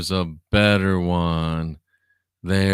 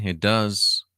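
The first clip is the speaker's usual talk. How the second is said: usual speaking voice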